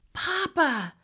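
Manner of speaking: put-on high, excited child voice; high-pitched pleading kid; eager little girl asking. A narrator's reading. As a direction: plead with papa to play. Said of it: "Olivia asked".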